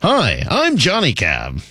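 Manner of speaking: drawn-out robot-cabbie greeting with swooping pitch; slow, sing-song put-on cab driver voice; Johnny Cab. Host mimicking a film's robot cab, slow swooping impression. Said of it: "like Johnny Cab from Total Recall".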